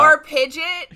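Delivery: Pidget, short second syllable like widget. Shown as a demonstration. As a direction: say Pidget to rhyme with fidget. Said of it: "people that say Pidget".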